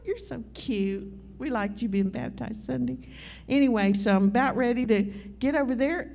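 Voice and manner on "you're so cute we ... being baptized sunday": tender aside. Real, not acted. Pastor turns to praise a child, soft and tender.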